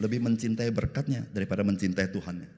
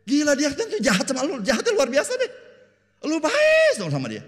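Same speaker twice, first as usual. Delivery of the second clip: high, loud incredulous friend; exasperated friend; 13 dB louder than usual. Acted. Preacher voices a friend shocked at kindness, loud high disbelief.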